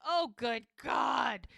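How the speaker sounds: loud-ish, drawn-out dramatic groan of words; mock dismay. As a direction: act out appalled disbelief, stretching each word. Hope hears the blurted news.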